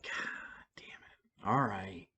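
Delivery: whispered resigned curse; exasperated whisper. Retold or imitated. Driver told to do sobriety tests, whispered curse. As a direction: breathe it out under your breath, defeated.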